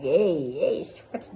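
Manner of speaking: loud startled hey, hey; half-joking panic at the ointment; mock alarm. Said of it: "I'm just like".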